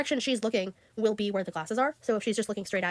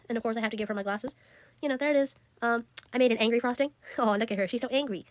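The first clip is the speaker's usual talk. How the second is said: normal talking voice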